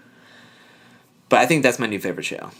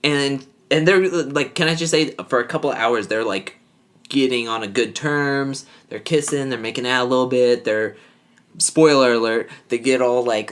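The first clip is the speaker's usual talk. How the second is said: ordinary delivery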